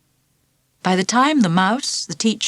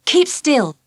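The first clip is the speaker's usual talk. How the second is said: firm order; 7 semitones higher than usual. Acted. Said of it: "she ordered Ralph".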